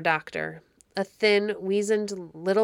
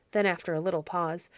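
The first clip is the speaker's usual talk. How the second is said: plain reading voice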